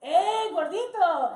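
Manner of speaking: high, loud, stretched-out call; big drawn-out shout; gleeful mock yell. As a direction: holler it like a street vendor. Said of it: "around the Malecon yelling".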